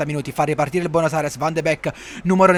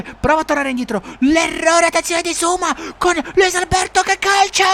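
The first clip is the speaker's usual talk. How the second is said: breathless italian goal commentary; 13 semitones higher than usual. Acted. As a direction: shout the goal. Goal call shout.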